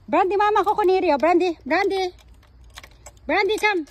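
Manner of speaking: loud, high-pitched holler; calling the dog. Real, not acted. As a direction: call urgently to your dog from afar.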